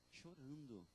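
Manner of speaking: a quiet repeated Chorando; soft echo. Shown as a demonstration. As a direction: let Chorando drop to a hush. Softer echo of the word.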